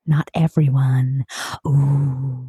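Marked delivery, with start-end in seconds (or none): 0.1-1.2 low, unvoiced dramatic whisper. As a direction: breathe it out as a secret. Whispered punchline of the blast.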